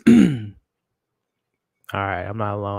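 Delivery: usual speaking voice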